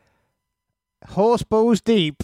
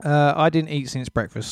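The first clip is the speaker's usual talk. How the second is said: normal talking voice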